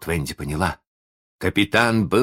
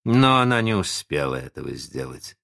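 usual speaking voice

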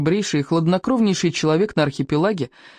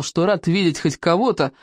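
ordinary delivery, nothing marked

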